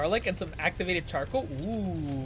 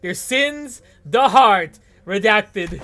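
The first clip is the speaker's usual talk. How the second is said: booming, drawn-out incantation with hard-hit key words; slow, theatrical proclamation; dramatic verse reading; 7 dB louder, much slower than usual. Acted. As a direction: intone it grandly, swelling loud on the big words.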